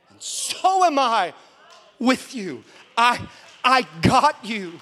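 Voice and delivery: slow, loud, high declaration; emphatic proclamation. Retold or imitated. Preacher passing on God's reassurance to the church, loud and slow. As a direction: stretch it out and proclaim it. Preacher repeats the Spirit's words forcefully.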